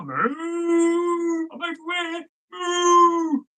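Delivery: high, drawn-out mooing cry; cow language. A narrator's reading. Cow calling up to the lad from below the cliff. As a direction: moo the words out like a calling cow. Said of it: "in cow language".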